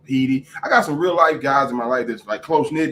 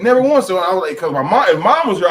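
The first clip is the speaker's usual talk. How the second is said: usual speaking voice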